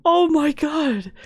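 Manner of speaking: high, drawn-out gushing delight; elated, high-pitched celebration; thrilled cheering. Real, not acted. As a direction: squeal with triumph after a great take. Cheering their finished acted scene.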